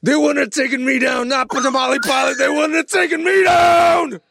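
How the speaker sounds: high, crying heroic shout; anguished shouting. Acted. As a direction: shout it like a wounded hero. Acted tamale pilot heroic rant.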